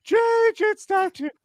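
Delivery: very high, slow singsong squeak; squeaky kid voice. Retold or imitated. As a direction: croon it slowly in a helium squeak. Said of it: "That's just thirteen-year-old Cardiff".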